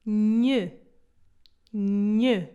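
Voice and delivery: nhe nasal, air through the nose; model sound nhe. Shown as a demonstration. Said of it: "Ouçam lá".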